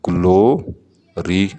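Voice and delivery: Glory stretched slowly into two beats; slow syllable demo. Shown as a demonstration. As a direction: draw out glory for the class. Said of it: "glory में दो syllable". ("Glory" धीरे, दो हिस्सों में)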